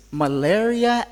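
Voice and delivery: ordinary talk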